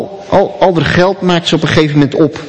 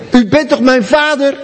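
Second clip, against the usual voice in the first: high pleading question with stress on toch; pleading daughter; 8 semitones higher than usual. Retold or imitated. Homeless daughter clinging to her father's feet, pleading high. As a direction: beg him to admit it, pitched up.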